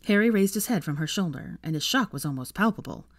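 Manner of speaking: ordinary delivery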